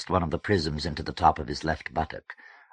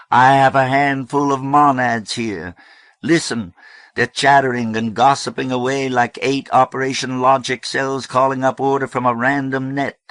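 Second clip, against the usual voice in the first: loud, high pulpit voice; fiery preaching; 8 semitones higher, 8 dB louder than usual. A narrator's reading. Reverend Amy preaching to the hall, fiery. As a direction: ring it out to a crowd.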